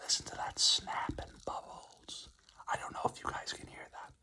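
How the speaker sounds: very soft, breath-only whisper; ASMR whisper. Pretend. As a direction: whisper close into the mic over the fizz. ASMR can-opening close to mic.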